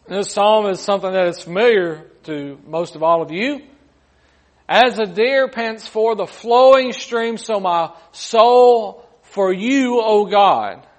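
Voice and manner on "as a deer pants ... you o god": slow, raised voice reading scripture; impassioned scripture reading. Acted. Preacher reads Psalm forty-two to the congregation, impassioned scripture reading. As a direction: read it out high and stretched, leaning on key words.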